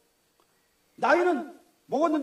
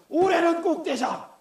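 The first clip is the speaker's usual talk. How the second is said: mock child resolve; 6 semitones higher, 3 dB louder, slower than usual.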